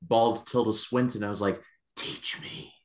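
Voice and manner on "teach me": awed mock whisper; tiny, reverent whispered request; hushed plea. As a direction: whisper it like a begging disciple.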